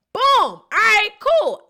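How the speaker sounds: high, loud burst of glee; triumphant. Real, not acted. Davon celebrating his tears fooled everyone, high excited whoop. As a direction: crow that the plan worked.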